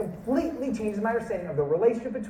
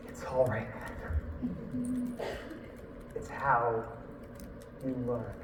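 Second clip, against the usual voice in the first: gentle reassurance; 7 semitones lower, 12 dB quieter, much slower than usual. Retold or imitated. Hand on shoulder, reassuring.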